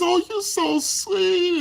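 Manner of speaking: high, slow sugary put-on voice; gushing friend. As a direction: coo it drawn out and high. Girl's gushing reply voiced.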